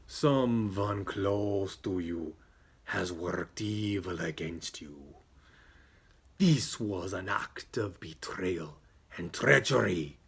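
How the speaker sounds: slow, drawn-out mystic voice; slow fortune-teller pronouncing doom; ominous psychic. Acted. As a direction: intone a grave warning, word by word.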